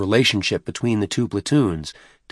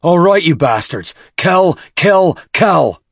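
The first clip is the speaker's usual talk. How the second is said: fierce battle cry; 9 semitones higher, 7 dB louder, slower than usual. Acted. Sergeant urges fighters on.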